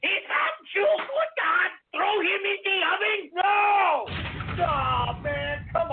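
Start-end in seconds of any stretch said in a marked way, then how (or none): 0.1-4.1 squeaky crying voice. Acted. Squeaky cry voice.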